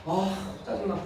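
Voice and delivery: muttered annoyance. Retold or imitated. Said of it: "갑자기 짜증이 확 올라와요".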